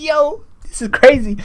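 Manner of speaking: very high, slow stunned exclamation; amazed. Retold or imitated. Viewer stunned by the top knot cut, very high slow amazement. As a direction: let the amazement squeal out. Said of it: "I was like".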